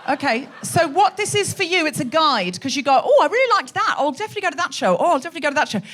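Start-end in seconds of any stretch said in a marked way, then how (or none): 3.0-5.8 lightly hammed-up keen audience member; higher, eager quote of a would-be fan; mock enthusiasm. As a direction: play at being keen. Acting keen audience picking shows.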